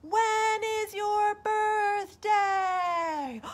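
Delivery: high, very slow echoed question; the echo answering bear back. Acted. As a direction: draw it out like a far echo. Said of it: "he listened and he heard".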